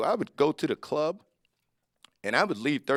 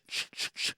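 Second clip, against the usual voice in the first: soft unvoiced sh-sh-sh rattle; imitated car noise; 8 dB quieter than usual. Describing the car's mystery noise, soft unvoiced shushing. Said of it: "that little sh".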